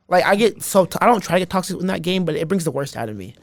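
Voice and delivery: normal talking voice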